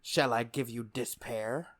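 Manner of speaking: slow and low, savouring the threat; mock Sephiroth. Villain promising despair to the hero, low and slow.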